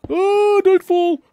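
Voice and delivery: very high, loud panicked cry; alarmed. Real, not acted. Gamer afraid of dropping something expensive, alarmed yelp. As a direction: cry out to stop a fall. Alarm at item about to fall.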